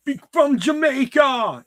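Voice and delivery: high, loud, slow mock Jamaican accent. Shown as a demonstration. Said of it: "I can't even do that one".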